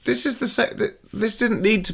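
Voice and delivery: mock confused musing; baffled inner voice. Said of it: "you're like".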